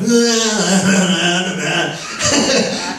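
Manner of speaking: mock clueless la-la noise; oblivious nonsense babble. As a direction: babble along, oblivious to any call. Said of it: "And Brett's like".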